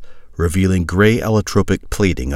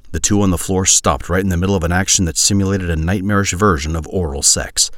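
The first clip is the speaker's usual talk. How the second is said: ordinary delivery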